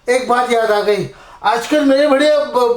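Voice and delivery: normal talking voice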